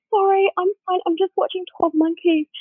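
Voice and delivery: high, mock-weepy excuse; imitated tearful voice. Explaining her wailing to a flatmate, mock-tearful. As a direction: insist you're fine through pretend tears. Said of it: "really crying".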